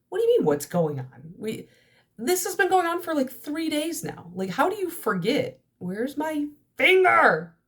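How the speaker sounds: high-pitched, incredulous mock rant; mock exasperation. Retelling how she answered her boyfriend, high exasperated disbelief. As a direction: act baffled that he forgot, pitch climbing high.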